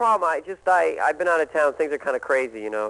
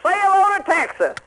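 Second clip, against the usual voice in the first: Ross Perot impression; 12 semitones higher than usual. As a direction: crow it like a brash Texas tycoon. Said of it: "as Ross Perot and say".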